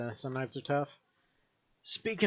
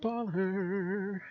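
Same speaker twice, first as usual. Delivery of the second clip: ordinary talk, nothing marked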